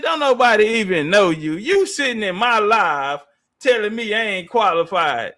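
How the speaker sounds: ordinary talk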